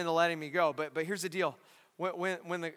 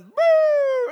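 high held siren wail, loud; loud high mock siren; siren imitation; 10 dB louder than usual. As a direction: imitate a police siren blaring. Police siren appears.

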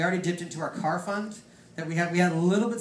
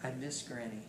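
mild crying; 5 semitones lower, 13 dB quieter than usual. Retold or imitated. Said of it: "my sister starts crying over the phone".

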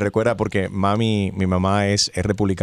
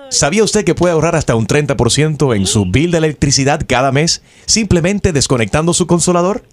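steady automated recorded-message voice; phone menu voice; 6 semitones higher, 7 dB louder than usual. Acted. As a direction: read it like a robotic phone menu.

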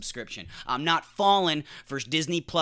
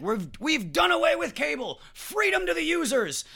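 high mock triumph; 14 semitones higher than usual. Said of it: "we were like".

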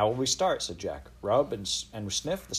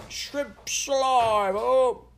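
very slow, high and loud Cockney call; a Cockney vendor bawling across the street, high and slow; Cockney street cry; 7 dB louder, much slower than usual. A narrator's reading. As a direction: drag out the shrimp seller's cry. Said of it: "street cries that resounded in Cockney accents".

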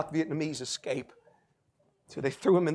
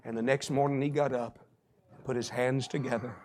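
low, soft storytelling; hushed testimony; 5 semitones lower, 7 dB quieter than usual. Retold or imitated. Preacher telling how the colonel finally slept, hushed. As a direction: let the story settle, low and reverent.

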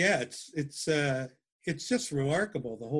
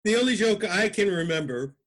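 ordinary talk, nothing marked

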